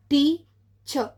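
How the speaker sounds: Teacher stretched slowly, weight on the first syllable; stress on TEA. Shown as a demonstration. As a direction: say teacher slowly, leaning on the start. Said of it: "ఎక్కడ ఎక్కువ stress పెడుతున్నారో".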